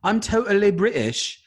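a bad put-on British accent; mock British accent, high and loud; horrible British accent. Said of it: "do a really horrible British accent".

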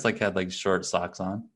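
ordinary delivery

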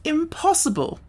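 high-pitched, emphatic dismissal; declaring. A narrator's reading. Wogglebug dismisses the boy's pain, declaring. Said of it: "declared the Wogglebug".

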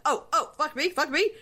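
high-pitched mock outrage, rising at the end; affronted echo. Host imitating husband echoing wife's insult, affronted. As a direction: throw the insult back as an incredulous question.

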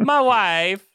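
high, loud drawn-out catchphrase impression; Borat. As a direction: belt out the catchphrase in a put-on voice. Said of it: "a Borat voice".

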